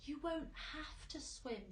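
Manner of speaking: slow, steady reassurance; calm. A narrator's reading. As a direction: soothe them gently. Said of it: "said James calmly".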